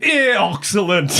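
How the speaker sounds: "Excellent" with every vowel as eh; weird vowels. Acted. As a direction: say "Excellent" as "Ehxcehllehnt", all eh. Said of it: "That was a weird vowel sound for the word excellent".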